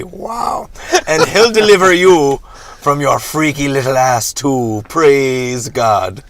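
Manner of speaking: loud fiery preacher; preaching. Acted. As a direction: preach it at full force. Reverend preaches at leprechaun.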